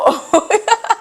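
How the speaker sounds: a high giggle after the punchline; high laugh. Real, not acted. Vlogger laughing at her retort to the police, high giggle.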